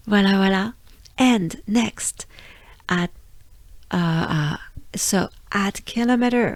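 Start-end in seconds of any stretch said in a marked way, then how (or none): none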